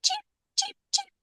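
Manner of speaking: tiny high whispered cheeps; baby chick cheeps. Acted. Newly hatched chicks peeping on Gerald's head, whispered and high.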